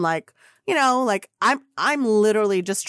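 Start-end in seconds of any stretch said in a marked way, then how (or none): none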